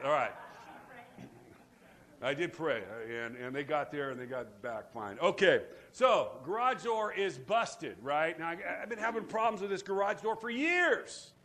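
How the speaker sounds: usual speaking voice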